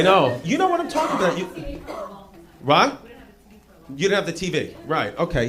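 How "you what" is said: usual speaking voice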